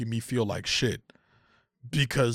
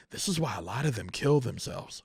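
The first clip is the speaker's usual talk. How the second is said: ordinary delivery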